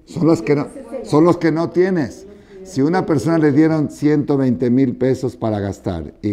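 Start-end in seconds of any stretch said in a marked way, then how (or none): 1.0-2.3 los punched high and slow in "son los que no tienes"; preacher's stressed punchline; emphatic punchline. Real, not acted. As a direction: drive home "los" with a higher, slower push. Hammering home his point.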